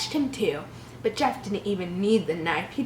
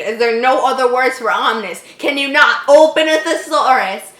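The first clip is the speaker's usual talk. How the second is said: louder, rising exasperated rant; 3 semitones higher, 9 dB louder than usual. Real, not acted. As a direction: rant at the author. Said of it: "this has made me angry".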